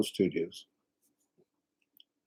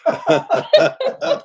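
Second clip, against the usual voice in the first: laughing; 8 dB louder than usual. Real, not acted. Laughing at the fan comment.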